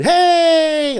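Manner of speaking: loud, high group-style holler; greeting cheer. Retold or imitated. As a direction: imitate a whole room hailing a regular. Room greeting a popular newcomer.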